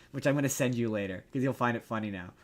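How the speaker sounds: ordinary delivery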